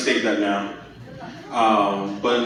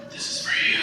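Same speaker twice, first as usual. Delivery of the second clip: breathy whisper; whispered line; 5 dB quieter, much faster than usual. Retold or imitated. Whispering to his partner mid-act, intimate and hushed. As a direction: whisper it intimately into her eyes. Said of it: "look her in the eye and be like".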